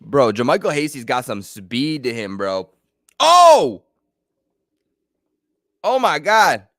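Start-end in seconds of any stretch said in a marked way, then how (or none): 3.2-6.7 a sudden, high, loud shock; startled cry. Real, not acted. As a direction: yelp as the cooler goes flying. Player knocks over the Gatorade.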